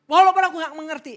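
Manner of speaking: loud, high plea of faith; anguished cry. Acted. Preacher acting out a prayer of faith, anguished cry. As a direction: call out to God through confusion. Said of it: "Semua tangisan, kemarahan semua ada di situ".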